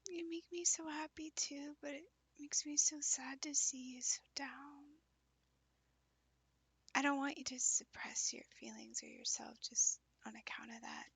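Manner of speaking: soft unvoiced whisper. Real, not acted. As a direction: whisper it close and gentle. Speaker whispers all lines.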